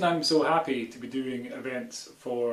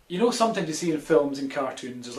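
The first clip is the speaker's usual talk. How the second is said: ordinary talk, nothing marked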